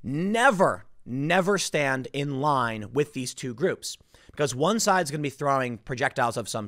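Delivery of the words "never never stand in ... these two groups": never, never stand in line: both nevers hit hard and drawn out, rest slow; stress on never. Real, not acted. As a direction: lean hard on never, never, like a firm warning. Emphatic warning to reporters.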